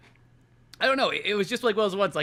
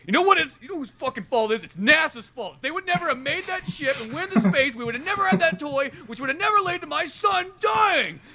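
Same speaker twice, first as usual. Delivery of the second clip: higher, louder mock outraged shout; grieving father's rant; 9 semitones higher, 6 dB louder than usual. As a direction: blame everyone in a hammed-up rage. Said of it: "the father's like".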